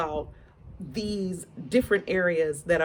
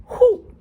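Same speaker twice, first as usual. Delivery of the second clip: a loud, high whew of exhaustion; 12 dB louder than usual. Real, not acted. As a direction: blow it out like it's too much. Exhale after gossip.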